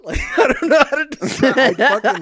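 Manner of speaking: imitated jolt then loud laughter; laughing. Real, not acted. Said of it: "a lightning bolt hit my body".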